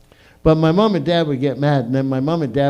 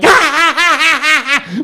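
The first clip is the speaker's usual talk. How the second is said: high loud giggling bursts; nervous kid laughter; 10 semitones higher, 5 dB louder than usual. Retold or imitated. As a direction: laugh you can't hold back. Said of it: "I'm like".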